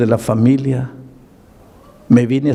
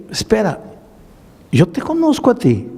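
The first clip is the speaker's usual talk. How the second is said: surprised recognition; 10 semitones higher than usual. Retold or imitated. Pastor recognizes the man.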